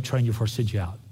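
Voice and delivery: ordinary delivery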